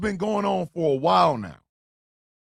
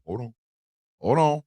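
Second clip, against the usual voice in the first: usual speaking voice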